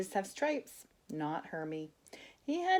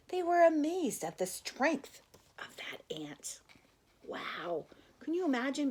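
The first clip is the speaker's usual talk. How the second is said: hushed, amazed whisper; awed whisper; 8 semitones higher than usual. Acted. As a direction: whisper it in wonder at the strong ant. Reader whispers the wonder.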